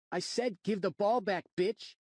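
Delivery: put-on a mouthy boy sneering at a grown-up; bratty kid. Rude kid demanding ball.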